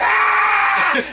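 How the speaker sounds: high shouted kiai yell, hammed-up; mock kung fu scream. Imitating the movie hero's scream, mock kiai yell. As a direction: let out a mock martial arts war cry. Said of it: "he does that scream".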